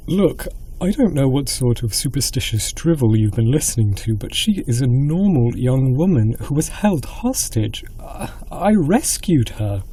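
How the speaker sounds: frustrated. Acted. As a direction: push back in rising frustration. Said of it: "threw up his hands with frustration".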